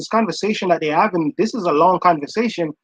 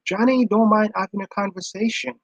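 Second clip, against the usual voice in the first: normal talking voice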